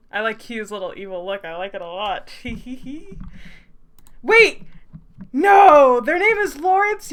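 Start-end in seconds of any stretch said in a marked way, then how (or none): 4.2-7.1 high, loud cry of protest; loud, high-pitched wail of dismay; dismayed outburst. Real, not acted. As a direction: shout it in horrified disbelief. Dismayed outburst at character's name.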